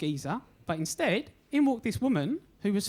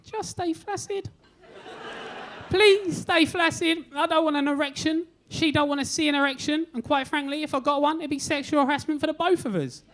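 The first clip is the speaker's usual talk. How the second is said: patient silently begs during the ultrasound, panicking; panicked plea; 4 semitones higher than usual. Retold or imitated. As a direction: beg your body not to betray you. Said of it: "What I was actually thinking".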